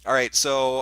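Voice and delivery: ordinary talk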